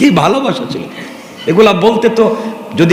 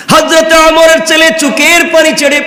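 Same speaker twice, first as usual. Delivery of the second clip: impassioned preaching; 8 semitones higher than usual. Acted. Preacher telling the story of Husain to the crowd, impassioned. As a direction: sweep the crowd along in full voice.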